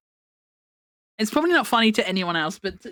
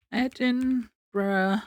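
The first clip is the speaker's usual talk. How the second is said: slow, stretched syllables with a soft voice; drawn-out typing aloud; 5 dB quieter, much slower than usual. Real, not acted. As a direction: sound it out slowly while typing it. Saying search words while typing them.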